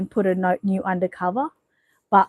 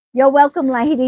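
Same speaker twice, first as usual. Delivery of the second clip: higher and louder greeting; loud cheery welcome; 5 semitones higher, 6 dB louder than usual. Real, not acted. Streamer to chatter Lady, loud cheerful welcome. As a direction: call it out brightly to her.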